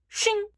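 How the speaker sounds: high, ringing blade "shing"; horror-movie knife sound. Retold or imitated. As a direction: imitate a knife being picked up in a slasher film. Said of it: "make, like, horror movie sounds".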